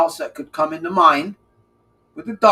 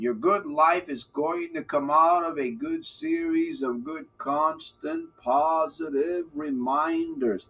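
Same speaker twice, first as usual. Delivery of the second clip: very slow, each word drawn out; slow motion; 3 dB quieter, slower than usual. Shown as a demonstration. Coach repeating his motto to viewers, slowly. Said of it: "I'll say it in slow motion".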